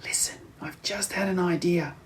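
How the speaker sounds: soft, urgent whisper; hushed conspiratorial whisper. A narrator's reading. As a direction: whisper the plan so the giant can't hear.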